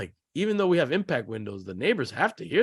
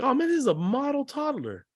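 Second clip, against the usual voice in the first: mock proud parent; 12 semitones higher than usual. Parents chatting, mock inner voice.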